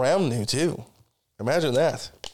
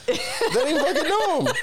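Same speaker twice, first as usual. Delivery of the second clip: high laughing squeal; laughing it out, pitch jumping up. Real, not acted. Laughing at her dad blessing strangers, high squeal.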